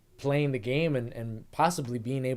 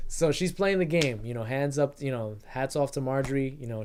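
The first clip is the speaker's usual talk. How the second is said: normal talking voice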